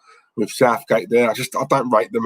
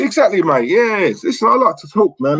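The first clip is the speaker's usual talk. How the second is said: excited agreement; 9 semitones higher than usual. Real, not acted. Agrees eagerly with chat.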